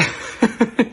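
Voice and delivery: a real high laugh; bright laugh at herself; high burst of laughter. Real, not acted. Laughs at own story.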